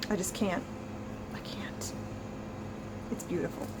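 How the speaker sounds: overcome, dropping to a whisper; words fading to a breathless, voiceless whisper; choked-up whisper. Real, not acted. Reader moved by the book's ending, whispering softly. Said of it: "I'm getting a little emotional".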